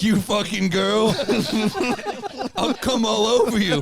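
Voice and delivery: high, slow leering taunt; mock dirty talk. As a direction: leer it out mockingly. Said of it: "You're like".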